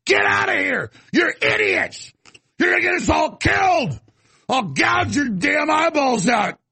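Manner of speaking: high, raging yell at the fools; furious shouting. Retold or imitated. Yelling at clueless mechanics, furious high shout. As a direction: scream at them to get out. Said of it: "it's just like".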